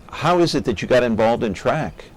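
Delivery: ordinary delivery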